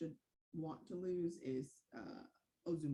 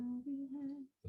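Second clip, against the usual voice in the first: mock Lamar voice; 7 semitones higher, much slower than usual. Mimicking Lamar's voice.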